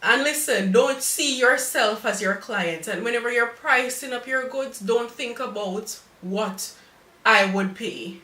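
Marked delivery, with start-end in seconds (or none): none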